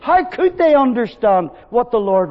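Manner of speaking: loud, high, ringing preaching; preaching at full force. Acted. Preacher pressing his reasons on the congregation, full force. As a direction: drive each reason home to the hall.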